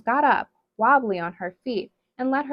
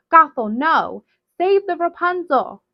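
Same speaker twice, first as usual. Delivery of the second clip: loud, high desperate cry to save the flower; frantic scream; 8 semitones higher, 8 dB louder than usual. Acted. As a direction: scream it as everything burns. Mother watches the Rapunzel burn.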